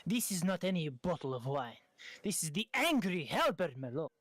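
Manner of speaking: put-on emphatic French-accented vendor; proud French sommelier defending his bottle; French wine seller. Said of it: "Why is he a Frenchman".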